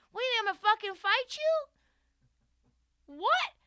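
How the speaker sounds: shouted up high with disbelief; coach yelling. Retold or imitated. Coach yelling at his star player mid-huddle, furious shout. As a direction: yell it like the coach losing it. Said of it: "words that he actually said out loud".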